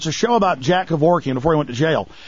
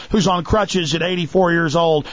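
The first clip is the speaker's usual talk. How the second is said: normal talking voice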